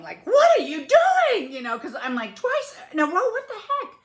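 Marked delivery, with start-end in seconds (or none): 0.3-1.5 very high, loud, drawn-out yell; retold yelling. Wife to husband flat on bathroom floor, retold yelling. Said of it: "at this point, I'm yelling". 2.3-3.9 high, loud exasperation with a laugh; exasperated disbelief. Retold or imitated. Wife reacting to husband's second fall, exasperated disbelief.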